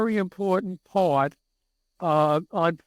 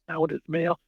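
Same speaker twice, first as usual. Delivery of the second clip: normal talking voice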